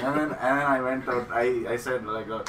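ordinary talk

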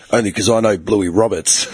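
a hint of a low, gruff Aussie dad; lightly voiced old bloke bragging. Father scolding son after bailing him out, lightly gruff. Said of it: "he had that full, like, "Yeah," you know, that voice".

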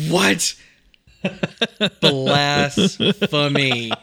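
slow scandalised Blasphemy; outraged. Acted. Outraged at guest's opinion.

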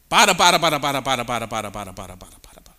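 rapid-fire nonsense syllables, trailing away; babbling. Shown as a demonstration. Preacher demonstrating babbling to the congregation, a droning fade. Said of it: "talking like this".

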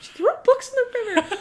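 high, laughing disbelief; can you believe it; laughing incredulous repeat. Real, not acted.

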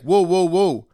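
loud, high retold objection; cutting in. Retelling cutting off the driver's excuse, loud. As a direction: break in to stop the argument. Cuts the other driver off in argument.

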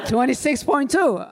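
slow, loud, laughing through it. Real, not acted. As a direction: say it while cracking up. Answer broken by laughter.

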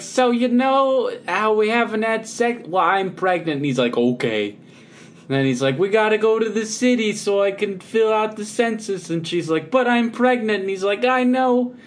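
high, loud, hammed-up New York Italian; New York Italian accent. Shown as a demonstration. Mary and Joseph bickering over the census, New York Italian accent. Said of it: "New York Italian accent".

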